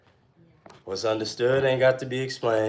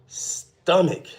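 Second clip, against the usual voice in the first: ordinary talk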